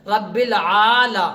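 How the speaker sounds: slow, syllable by syllable; measured, drawn-out teaching recitation; spelling-out recitation. Shown as a demonstration. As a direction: draw out each syllable for learners. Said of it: "آج میں ہجا کر رہا ہوں".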